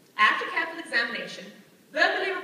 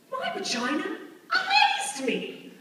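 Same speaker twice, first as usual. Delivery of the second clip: very high, loud, drawn-out wonder; stretched-out high-pitched awe; amazed; 12 semitones higher, 4 dB louder, slower than usual. Acted. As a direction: squeal it slowly in astonishment. Awestruck at own discovery.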